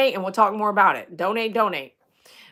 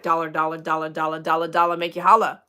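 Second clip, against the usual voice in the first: imitating fortune-teller; 5 semitones lower, faster than usual. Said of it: "This lady's a telling you the future".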